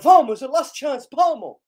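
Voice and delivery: high, loud urging; excited cheering. Real, not acted. Fan at the last chance, excited shouting. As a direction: shout them on for the final push.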